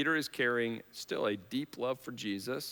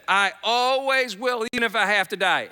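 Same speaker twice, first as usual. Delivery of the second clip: Peter's vow recalled; 9 semitones higher, 8 dB louder than usual. Retold or imitated. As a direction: shout the promise out. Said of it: "He said".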